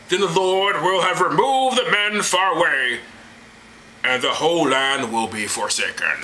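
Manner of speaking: hammed-up divine oration; God's voice. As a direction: declaim doom as the Almighty. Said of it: "And God answered".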